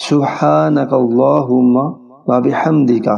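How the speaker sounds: سبحانک اللہم و بحمدک slow, each letter clear, بحمدک with bi; careful recitation. Shown as a demonstration. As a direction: recite slowly and precisely, بحمدک as bi-hamdik. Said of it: "غور سے سنیں". (آہستہ اور صاف تلفظ سے پڑھنا)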